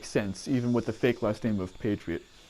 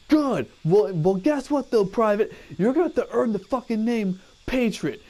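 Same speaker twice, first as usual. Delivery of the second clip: drill sergeant; 7 semitones higher than usual. Retold or imitated. Drill sergeant yelling at recruit.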